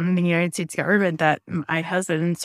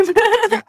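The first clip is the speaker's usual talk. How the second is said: high, louder burst of laughter; 6 dB louder than usual. Real, not acted. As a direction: laugh out at yourself. Laughs at her own crying.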